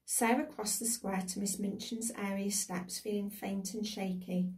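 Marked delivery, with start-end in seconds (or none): none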